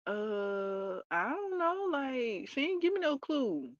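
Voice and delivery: high, whiny mock cluelessness; acted-out helpless whine; clueless protest. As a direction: plead ignorance in a high, stretched-out voice. Acts clueless girl answering the guide.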